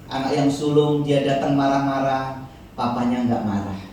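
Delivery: ordinary delivery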